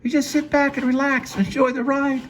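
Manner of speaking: very high falsetto woman voice; Jane's voice. Acted. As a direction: squeak it out high and sweet. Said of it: "The voice of Jane off screen".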